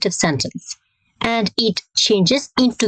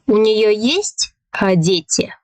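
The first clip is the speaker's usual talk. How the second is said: sharp rise on "есть", then drop; rising question intonation. Shown as a demonstration. Teacher demonstrating a Russian question to learners, pitch jumping up on "есть". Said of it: "here we have a rising intonation".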